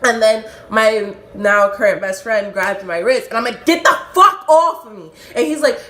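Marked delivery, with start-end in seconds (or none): 3.6-5.1 high, loud re-enacted protest; heated, high acted-out outburst; angry shout. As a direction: yell to shake off someone's grip. Friend grabs her wrist in fight.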